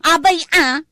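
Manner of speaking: loud, high "Ah!"; loud, surprised yelp; startled cry. Acted. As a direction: jump at the news. Surprised exclamation.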